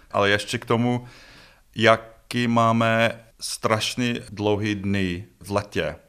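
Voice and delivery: usual speaking voice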